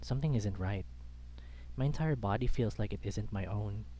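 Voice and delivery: usual speaking voice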